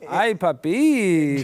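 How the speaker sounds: put-on slow drawn-out Jamaican accent; exaggerated Jamaican accent. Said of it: "the best Jamaican accent I've ever heard".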